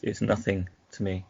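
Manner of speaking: ordinary talk, nothing marked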